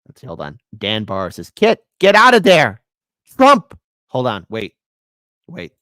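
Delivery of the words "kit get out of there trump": loud, higher shout of the warning; mock shout. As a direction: belt out the chat's alarm. Reads a chat as a shout.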